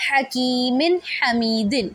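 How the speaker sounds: حکیمن حمیدن slow, with the tanween نون clear and no break; clear izhar. Shown as a demonstration. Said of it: "میں نے درمیان میں کوئی سکتہ نہیں کیا".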